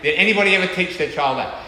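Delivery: ordinary delivery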